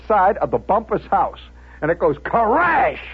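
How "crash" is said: high, drawn-out smashing noise; crash imitation. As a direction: stretch the word into a shattering crash. Imitates bottle smashing on house.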